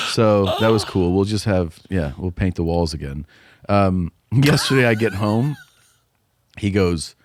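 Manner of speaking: usual speaking voice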